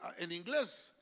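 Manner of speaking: ordinary talk, nothing marked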